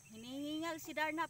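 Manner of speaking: normal talking voice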